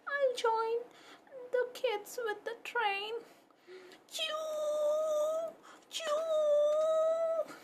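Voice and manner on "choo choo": very high little choo-choo; a shy pup joining the game; high puppy choo. Acted. Puppy Pepper joins the train game with a tiny high choo.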